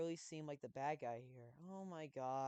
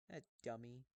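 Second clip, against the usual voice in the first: ordinary delivery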